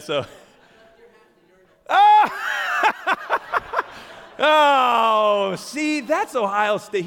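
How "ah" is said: sudden high yelp of surprise; a real startled cry, high and loud; surprised shout. Real, not acted. Startled by returning Brent.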